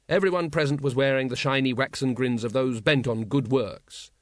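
ordinary delivery

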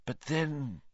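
soft, inward mutter. A narrator's reading. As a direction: mutter it as if thinking aloud. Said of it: "Lupin muttered".

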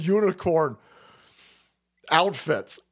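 ordinary delivery

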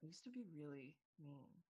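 quiet confession. Retold or imitated. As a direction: admit it very softly. Retells hesitant confession to a friend.

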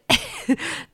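sudden laugh jumping very high; high squeaky laugh. Real, not acted. Laughing at herself over the spoiled surprise, squeaky giggle. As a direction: burst out in a nervous giggle. Laughs at her own worry.

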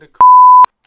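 censor bleep over the words; covered by a bleep tone. Real, not acted. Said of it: "Now we have to bleep that".

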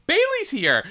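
high-pitched imitation of a startled announcement; mock surprise. Derek finds out who is in there, mock surprise. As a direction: pipe it up high, playfully amazed.

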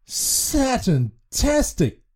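high, slowly savoured cheer; delighted exclamation. A narrator's reading. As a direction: draw the word out in delight. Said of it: "he exclaimed".

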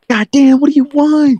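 angry yell; shouting. Retold or imitated. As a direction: yell it high and loud. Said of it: "This man said".

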